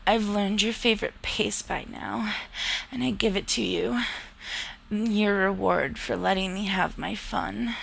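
ordinary delivery, nothing marked

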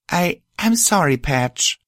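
high, hesitant apology; apologetic. A narrator's reading. Friend apologizes to crying mare after quarrel, apologetic. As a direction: falter into an earnest sorry.

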